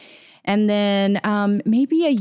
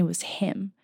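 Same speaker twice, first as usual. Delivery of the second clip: whispered, softer, in awe; hushed realization; 8 dB quieter than usual. Real, not acted. Telling friends she discovered the swami's picture, whispered in awe. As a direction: let it drop to a whisper. Realizing the author was him.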